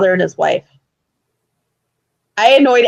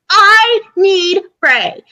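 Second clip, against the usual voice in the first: high, very slow pleading shout; whiny yell; 8 semitones higher, much slower than usual. Retold or imitated. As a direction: drag out the demand. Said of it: "going at it".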